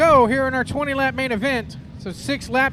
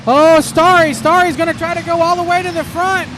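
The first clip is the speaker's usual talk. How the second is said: lively live race call; motorsport commentator; 5 semitones higher, 6 dB louder than usual. Real, not acted. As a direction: call the laps as they unfold.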